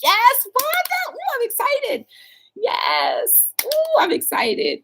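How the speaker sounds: very high excited cheer; excited squeal. Acted. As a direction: squeal with glee for her.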